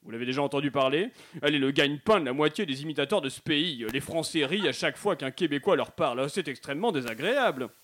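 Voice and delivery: put-on Quebec accent, a little low; Québécois accent. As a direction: complain as the offended accent of Quebec. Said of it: "accent québécois aimerait réagir". (accent québécois vexé)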